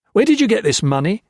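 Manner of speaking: loud, high angry question; angry demand. A narrator's reading. Gray angrily demands where the money came from, loud. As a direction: throw the question at him.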